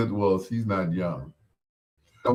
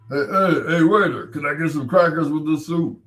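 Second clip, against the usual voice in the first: louder, pushy bully calling a waiter; Big Jake Nasty; 6 semitones higher, 6 dB louder than usual. Acted. [name]'s bully character calling a waiter, louder and pushy. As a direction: demand crackers, hammed-up. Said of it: "Big Jake Nasty".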